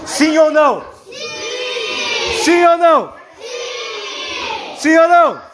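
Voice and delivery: rally the class, building to a shout. Real, not acted. Teacher polls class, rising.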